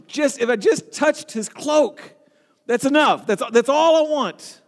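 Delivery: high, insistent inner plea; woman's eager plea. Acted. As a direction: plead it high, stretching the key words. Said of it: "she said to herself".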